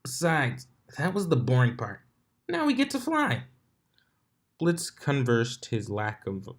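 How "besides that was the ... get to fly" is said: eager Dash voice. Acted.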